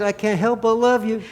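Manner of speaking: playful Southern sweet talk; Southern dialect. Pretend. Husband flirting with wife mid-conflict, Southern accent. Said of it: "when you're talking in that Southern dialect".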